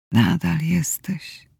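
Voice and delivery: barely audible, slow whisper; faint whisper. A narrator's reading. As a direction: breathe it out, almost no voice. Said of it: "Musiałam pochylić się niżej, żeby usłyszeć". (ledwie słyszalny szept)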